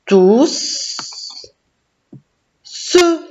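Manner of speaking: clap-along syllable split of "Tous … ce". Shown as a demonstration. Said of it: "Fais avec moi".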